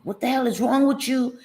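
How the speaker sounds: mock angry man. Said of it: "he started flipping".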